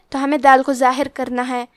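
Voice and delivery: ordinary talk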